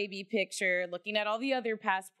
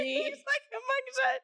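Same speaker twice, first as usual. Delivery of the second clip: laughing. Real, not acted. Laughing at daughter's awful photo.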